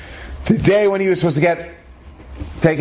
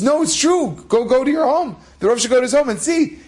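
high-pitched insistent retelling; acted urgency; 9 semitones higher than usual. Boy sending the Rav home to see, acted urgency. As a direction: urge him on in mock earnest.

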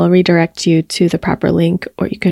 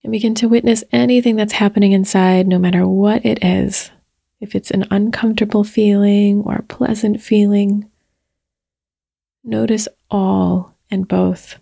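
unhurried meditation voice with long pauses; guided meditation. Real, not acted. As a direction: gently guide listeners into their bodies, leaving space between phrases.

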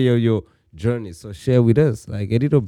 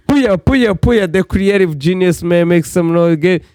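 dueling hype announcers; 5 semitones higher, 18 dB louder than usual. Acted. Hyping up guest like stage.

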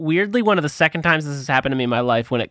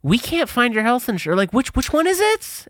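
higher-pitched questioning receptionist voice; puzzled receptionist; 9 semitones higher than usual. Retold or imitated. Receptionist cannot find the patient's insurance, puzzled and high-pitched. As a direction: imitate a clerk stuck on a lookup, pitched up.

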